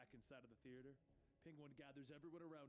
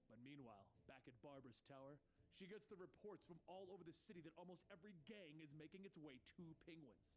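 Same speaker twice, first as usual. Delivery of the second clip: ordinary delivery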